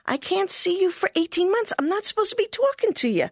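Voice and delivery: pleading with mom. Retold or imitated. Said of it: "And I said".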